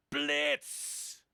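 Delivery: sudden high barked "Blitz!"; spring the quiz with a bark; bellow. A narrator's reading. Professor announcing a surprise quiz, mock bellow. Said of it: "stride in and bellow".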